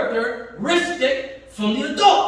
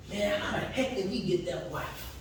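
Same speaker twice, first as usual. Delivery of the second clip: much softer, a low jealous grumble under the breath; envious muttering; 4 semitones lower, 12 dB quieter than usual. Retold or imitated. Preacher voicing a jealous man who covets a wife, muttered enviously.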